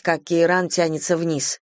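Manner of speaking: ordinary delivery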